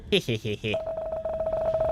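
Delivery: quick cartoonish titter; cartoon laugh. Exotic plant greets player.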